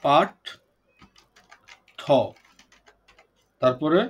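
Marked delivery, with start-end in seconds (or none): 0.0-2.0 "পাঠ ঠ" with a long pause between the halves; slow syllable breakdown. Shown as a demonstration. Teacher showing the class the split, "পাঠ ঠ" slowly in two pieces. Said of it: "ধীরে ধীরে উচ্চারণ করি".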